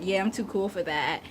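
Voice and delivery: a hint of dismissive cool; too-cool guy. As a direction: lightly imitate someone too cool to care. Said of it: "who's gonna be like".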